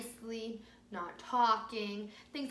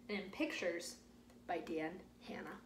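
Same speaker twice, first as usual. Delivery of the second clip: softly whispered name; 4 semitones lower, 6 dB quieter than usual. Real, not acted. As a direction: let the name fade to a whisper. Whispered end of the title.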